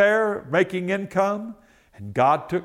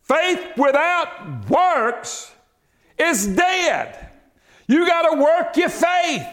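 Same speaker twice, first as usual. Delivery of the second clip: high, slow, shouted proclamation; full-force preaching; 10 semitones higher, much slower than usual. Acted. Preacher proclaiming scripture to congregation, high and shouted. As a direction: thunder it from the pulpit.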